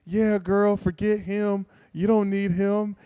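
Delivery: put-on high girlfriend voice; female posse. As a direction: mimic supportive girlfriends egging a friend on. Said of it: "her little female posse".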